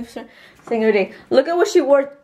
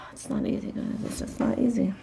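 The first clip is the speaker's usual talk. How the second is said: hushed confession; 2 semitones higher, 10 dB quieter than usual. Real, not acted. Mom confides about hard day.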